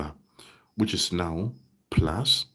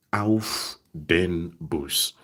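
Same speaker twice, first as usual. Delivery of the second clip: slow demo phrase; much slower than usual. Shown as a demonstration. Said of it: "And that's why I say".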